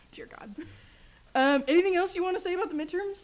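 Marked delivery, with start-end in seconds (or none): 0.1-0.5 breathless whisper through a laugh; exasperated whisper; whispered dear God. Real, not acted. Host whispering in disbelief at her cohost.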